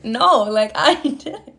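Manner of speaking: laughing through the words, slowing down; laughing admission. Real, not acted. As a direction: confess it through real laughter. Laughs at her own confession.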